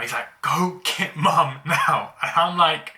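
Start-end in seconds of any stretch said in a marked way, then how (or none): 0.4-2.1 higher, louder, drawn-out retold yell; mock scream. Hurt brother screaming at sibling for mum, mock scream. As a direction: imitate a screamed order, stretching the words. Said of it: "he's screaming out".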